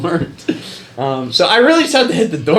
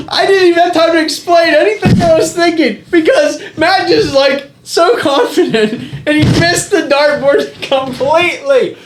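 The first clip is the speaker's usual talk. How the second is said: laughing; 12 semitones higher, 4 dB louder than usual. Real, not acted. Still laughing at the miss.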